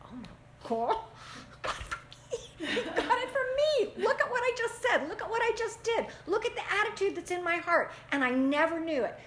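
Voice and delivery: high, laughing dismay at realizing it; shocked realization. Retold or imitated. Parent realizing the child copied them, laughing in shocked dismay. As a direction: catch yourself in the mirror, half laughing and high. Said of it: "you're going".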